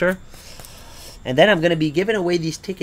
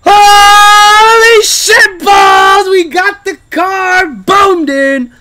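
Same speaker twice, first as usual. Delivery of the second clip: screaming with joy, much higher; ecstatic shout; 15 dB louder, much slower than usual. Real, not acted. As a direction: yell out the big win. Wins the car prize.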